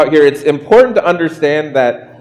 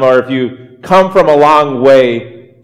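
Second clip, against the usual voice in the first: ordinary delivery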